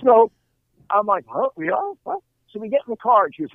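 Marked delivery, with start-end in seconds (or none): 1.3-2.2 high, confused squeak of disbelief; can't believe what he's hearing; baffled surprise. Retold or imitated. Husband baffled that they're going to the strip club.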